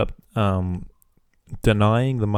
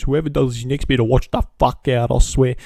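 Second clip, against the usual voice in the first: a touch louder, imitating the mum's threat; mock angry threat; 3 semitones higher, 4 dB louder than usual. Vlogger imitating the mum threatening the bullies, mock angry. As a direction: mimic her warning the bullies, half-hearted heat. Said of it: "she goes".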